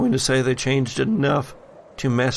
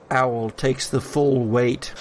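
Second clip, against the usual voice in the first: ordinary delivery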